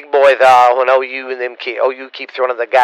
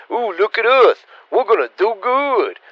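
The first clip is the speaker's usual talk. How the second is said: higher sing-song voice, mocking a rival's bragging; mocking boast; 9 semitones higher than usual. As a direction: put on a smug voice to make fun of them.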